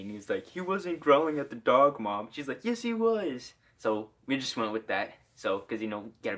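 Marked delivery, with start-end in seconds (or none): 0.5-2.3 higher-pitched imitation of a protesting son; sticking up for friend. Friend defends him to his mom, higher mock protest. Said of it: "stuck up for me". 2.6-3.5 high-pitched mock mom voice; insistent mom. Mom contradicts her son about growling, high mock insistence.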